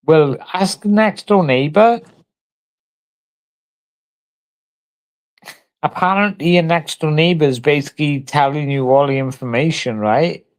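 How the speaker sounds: shrill-pitched mocking retort; sarcastic outburst. Real, not acted. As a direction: throw it back at them, sneering and high. Sarcastic rant at the questioner.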